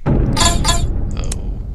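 sudden high shriek; a loud startled yelp. Real, not acted. Startled during game.

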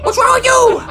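high-pitched frantic outcry; exasperated dismay. Acted. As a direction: squeal it at the laughing opponent. Ferb attacks laughing opponent.

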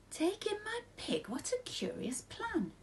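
put-on high, slow, doubting old lady; puzzled old lady. A narrator's reading. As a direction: squeak it out in disbelief, slowly.

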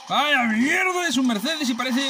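exasperated drawn-out yell. Real, not acted. Repeats complaint, louder and drawn out.

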